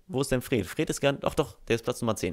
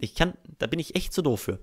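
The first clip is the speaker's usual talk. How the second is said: usual speaking voice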